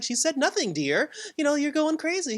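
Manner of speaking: high imitation of a dismissive wife; Wanda. Wanda denying anything happened to her husband, high imitation. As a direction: brush it off in a put-on high voice. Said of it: "And then she's like".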